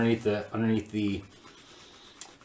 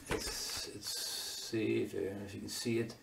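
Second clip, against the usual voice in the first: whispering; 16 dB quieter, slower than usual. Real, not acted. Leans close to the camera.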